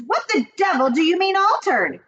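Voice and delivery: put-on an outraged father shouting at the old woman; high loud angry demand. A narrator's reading. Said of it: "shouted Mister Jenkins".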